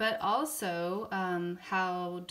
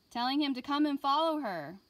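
read-aloud building higher and louder through the barks; lively classroom read-aloud; storybook reading, rising excitement; 5 semitones higher, 6 dB louder than usual. A narrator's reading. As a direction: get more excited with each bark. Reading picture book to class.

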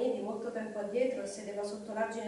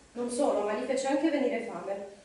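usual speaking voice

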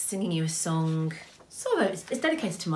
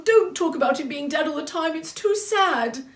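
high, loud distressed character; plead, dragging out the last words; upset plea; 12 semitones higher, 7 dB louder than usual. A narrator's reading.

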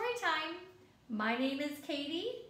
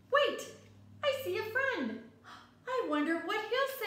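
excited; 7 semitones higher than usual. Acted. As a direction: perk up, you spot a friend. Boy spots a friend.

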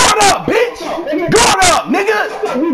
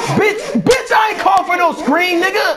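high-pitched, heated protest; frustrated high squawk at a teammate; exasperated yell; 6 semitones higher than usual. Real, not acted. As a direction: shout back in real outrage. Protesting to teammates mid-game.

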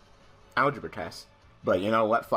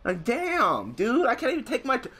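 high-pitched aggrieved ranting; exasperated complaint; 8 semitones higher than usual. Real, not acted. Gamer asking why the opponent quit, exasperated high whine. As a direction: demand an explanation, voice pitched up.

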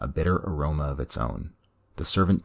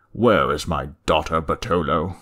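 louder, biting demand; seething fury; 6 dB louder than usual. Acted. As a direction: hold the rage just under a shout. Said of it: "Dessain's voice seethed with fury".

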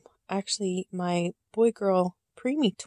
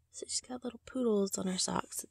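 whispered coo; 8 dB quieter than usual. Real, not acted. Cooing over poodle socks.

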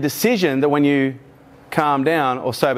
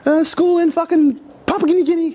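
much higher, fast, frantic babble; panicking parents; 13 semitones higher than usual. Retold or imitated. Mums and dads panicking about the market, high frantic babble. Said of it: "the mums and dads are going".

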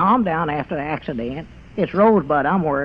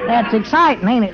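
usual speaking voice

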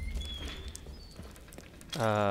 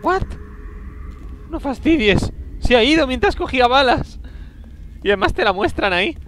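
much higher and louder, alarmed disbelief; startled outburst; 10 semitones higher, 3 dB louder than usual. Real, not acted. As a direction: blurt it out in shock. El monstruo desaparece de repente. (sorpresa alarmada, más aguda y fuerte)

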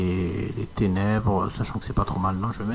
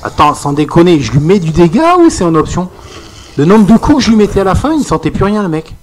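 loud, worked-up and disbelieving; exasperated rant; 5 semitones higher, 8 dB louder than usual. Real, not acted. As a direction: vent about your hits doing nothing. Frustrated rant about damage dropping.

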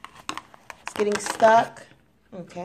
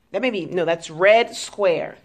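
ordinary talk

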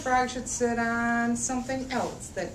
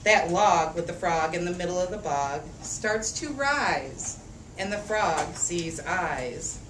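lively picture-book read-aloud; reading to children; 4 semitones lower, slower than usual. A narrator's reading. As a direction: read it out for a room of little kids. Reads picture book to kids.

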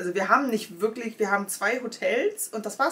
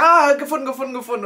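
higher, louder relieved cry; relieved shout; 6 semitones higher, 11 dB louder, faster than usual. Retold or imitated. Finally finding the button, loud relieved cry. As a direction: call out in relief. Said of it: "Ich sag".

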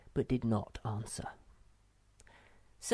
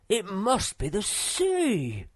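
high, slow, loud cry of delight; whoop of joy; 9 semitones higher, 6 dB louder, slower than usual. A narrator's reading. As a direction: shout it out, overjoyed at finding the sea. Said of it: "exclaimed Moomin Troll with a whoop of joy".

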